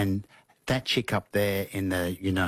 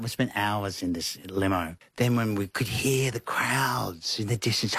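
ordinary talk

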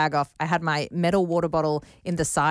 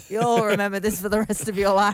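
higher-pitched mock proclamation, laughing into it; joking, raised-pitch self-important declaration; mock grand promise; 5 semitones higher, 3 dB louder than usual. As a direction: play along with a hammed-up promise to a child. Host plays along, addressing the child.

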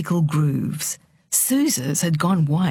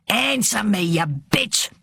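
raised, forceful order to answer; angry demand; 3 semitones higher, slower than usual. A narrator's reading. Father shaking his silent daughter, angry demand. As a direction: start high and come down hard.

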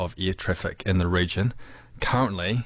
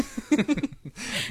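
high embarrassed laugh; 14 semitones higher than usual. Real, not acted. Teased about his accent.

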